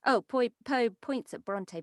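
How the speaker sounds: ordinary delivery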